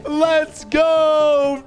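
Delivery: excited shout. Real, not acted. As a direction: shout it in triumph. Celebrating bully being grounded.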